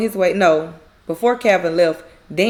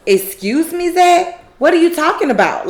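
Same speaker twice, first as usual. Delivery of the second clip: higher, taken-aback question; retold confusion; 7 semitones higher, 4 dB louder than usual. Karen asking Zach what he means, acted confusion. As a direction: question him in raised-pitch bafflement. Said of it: "She's clearly confused".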